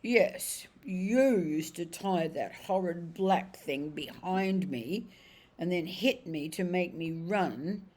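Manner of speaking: slow, heavy horse voice gaining force; a plodding old horse dredging up memories; remembering cab horse. Acted.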